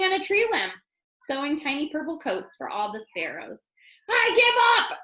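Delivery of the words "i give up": high, loud exasperated shout; king shouting in defeat at the quilt maker. A narrator's reading. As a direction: throw your hands up and yell it. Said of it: "the king shouted".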